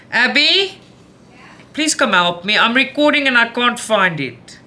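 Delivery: high, loud call across the house; mother calling her daughter to find scissors. Real, not acted. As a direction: call your daughter from another room.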